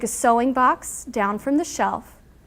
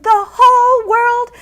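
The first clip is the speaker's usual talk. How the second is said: much higher, louder, slow scream; 12 semitones higher, 10 dB louder than usual. A narrator's reading. Angry Toad jumping up and down, screaming. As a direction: scream it in a rage. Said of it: "and screamed".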